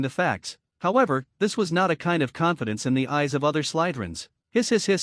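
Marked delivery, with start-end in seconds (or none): 4.5-5.0 hissing like a snake. Acted. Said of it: "snake calls".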